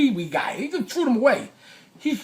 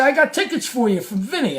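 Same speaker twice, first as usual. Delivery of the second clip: raised-pitch, eager retold announcement; friendly call-out; 6 semitones higher than usual. Storyteller closing in on Jim with tickets, friendly call-out. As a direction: call it ahead as you approach. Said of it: "now I'm with a smile".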